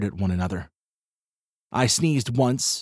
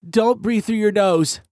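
plain reading voice

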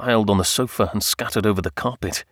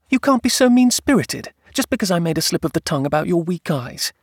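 very high, indignant opening, then easing; wife protesting; 8 semitones higher than usual. Acted. Wife scolds husband over slip about eyes, indignant.

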